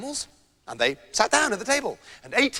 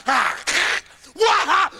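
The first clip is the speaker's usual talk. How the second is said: higher, louder startled yelp; mock yell; 7 semitones higher, 5 dB louder than usual. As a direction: yelp as if bitten. Hunter bitten by dog.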